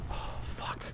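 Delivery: breathy under-breath curse; whispered curse. Real, not acted.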